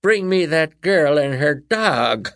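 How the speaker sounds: slow, loud shrieking witch. A narrator's reading.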